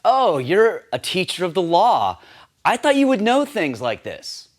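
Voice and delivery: high, loud mocking sarcasm; sarcastic. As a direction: needle him with dry sarcasm.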